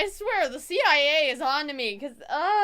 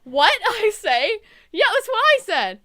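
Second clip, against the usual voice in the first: higher, loud and emphatic; loud vindication; 5 semitones higher, 11 dB louder, slower than usual. Real, not acted. Player proven right by the game, loud vindication. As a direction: crow it loudly.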